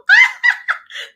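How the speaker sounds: high, loud whoop of laughter; a burst of real laughter; loud rising laugh. Real, not acted.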